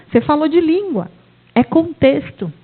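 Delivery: normal talking voice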